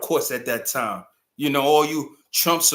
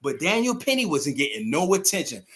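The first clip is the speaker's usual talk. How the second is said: ordinary delivery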